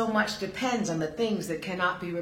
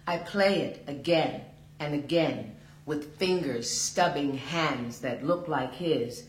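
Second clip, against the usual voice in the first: usual speaking voice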